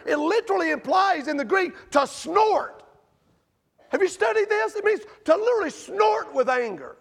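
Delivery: shouted sermon emphasis; emphatic preaching. Real, not acted.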